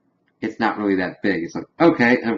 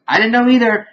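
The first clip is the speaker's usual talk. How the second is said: panicked denial; 11 semitones higher than usual. Retold or imitated. Stan panics in the bathroom.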